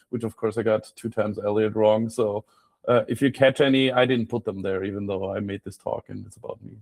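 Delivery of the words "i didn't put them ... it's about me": ordinary delivery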